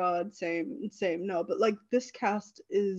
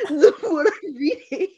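laughing; 6 semitones higher, 6 dB louder than usual. Real, not acted. Laughing while reading description.

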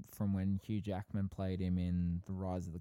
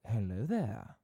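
Obi-Wan impression; slower than usual. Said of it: "I mean, Obi-Wan".

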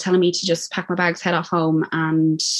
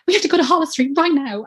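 higher-pitched urgent announcement; urgent bursting in; 9 semitones higher than usual. Retold or imitated. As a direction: burst in with an urgent demand, retold. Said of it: "busting in the door".

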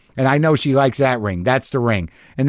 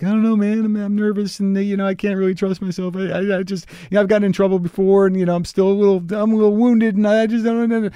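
higher, timid nervous little voice; voice of his heart; 5 semitones higher than usual. Retold or imitated. His heart answering his pep talk, higher and timidly nervous. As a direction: play his scared heart, small and unsure. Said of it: "And my heart's like".

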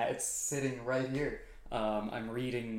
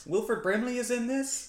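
surprised; 12 semitones higher, 6 dB louder than usual. Real, not acted. Learning the movie's cast.

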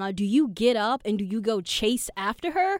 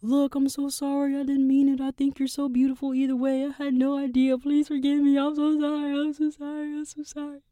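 higher, begging voicemail voice; pleading apology; 5 semitones higher than usual. Retold or imitated. Leaving a voicemail for the date who ran off, pleading higher. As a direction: plead for forgiveness into the phone. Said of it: "So I leave a voicemail".

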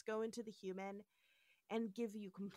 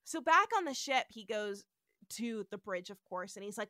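ordinary delivery

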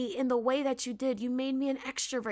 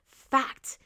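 "Fact" set apart by pauses and punched; emphasis on fact; 3 dB louder than usual. Shown as a demonstration. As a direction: land hard on "Fact" as one crisp standalone word. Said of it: "I want to emphasize on the word fact".

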